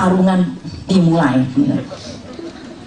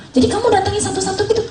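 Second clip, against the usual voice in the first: surprised father-in-law; 13 semitones higher, much faster than usual. Retold or imitated. Father-in-law asking, retold.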